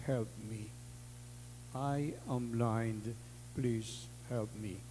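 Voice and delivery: soft, slow plea for help; a hint of a begging blind child; pleading blind child. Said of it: "nga na".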